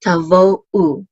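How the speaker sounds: "ta vo u" slowly, one syllable at a time; sounding out syllables. Shown as a demonstration. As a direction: spell out "ta vo u" by sound for learners.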